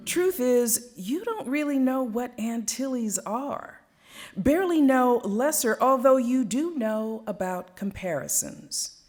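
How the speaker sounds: lively, swelling poetry performance; spoken-word poem, rising and falling with the tale. Acted. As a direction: tell it like a story to a full room. Performs her second poem.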